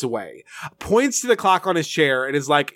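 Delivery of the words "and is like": ordinary delivery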